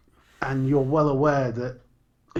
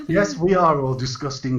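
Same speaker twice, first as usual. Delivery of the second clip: normal talking voice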